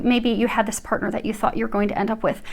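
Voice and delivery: ordinary delivery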